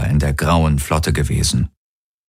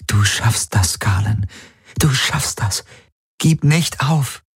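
rising, higher pleading; pleading encouragement; 5 semitones higher than usual. Acted. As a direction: urge him to hold on. Kirai pleads, hands clasped.

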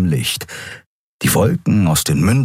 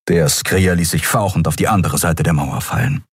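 usual speaking voice

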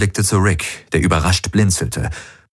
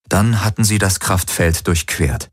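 ordinary delivery

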